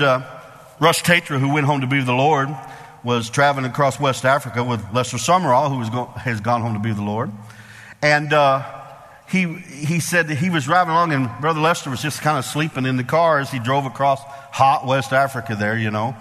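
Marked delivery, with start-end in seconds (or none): none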